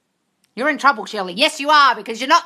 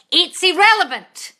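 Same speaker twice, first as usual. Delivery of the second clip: sharp outburst; 7 semitones higher, 9 dB louder than usual. Real, not acted. Ranting at viewer's excuse.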